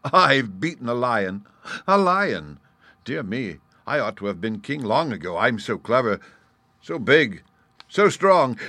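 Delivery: gloating, rising to high, loud boasts; proud crowing. Acted. Gnat gloating after beating a lion, crowing proudly. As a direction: swell with pride as you list your virtues. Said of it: "exclaimed the gnat as he proudly plumed".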